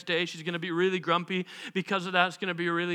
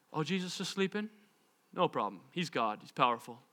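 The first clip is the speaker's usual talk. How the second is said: quiet, low, unbothered; nonchalant disciples; 4 semitones lower, 6 dB quieter than usual. Acted. Disciples spot Jesus sleeping on the boat, casually untroubled. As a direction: shrug it off softly, easygoing.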